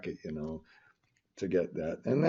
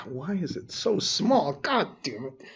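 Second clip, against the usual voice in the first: higher-pitched exasperated complaint; 7 semitones higher than usual. Real, not acted. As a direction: curse at the screen in frustration. Cursing tiny screen, then apologizes.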